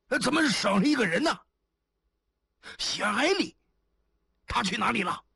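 high, strained cry of alarm; hoarse alarmed cry. A narrator's reading. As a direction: cry out hoarsely in sudden panic. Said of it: "一个声音在沙哑地惊叫". (沙哑地惊叫)

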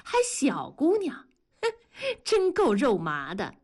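high, mocking echo with a scornful huff; sneering. A narrator's reading. Wife mocks husband's fond words, sneering. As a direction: repeat his words in disgust. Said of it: "文丽冷笑着说".

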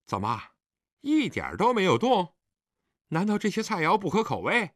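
puzzled, voice climbing high; 皱眉不解. A narrator's reading. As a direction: question it with a frown, pitch rising. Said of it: "皱着眉头说". (皱着眉头高声疑问)

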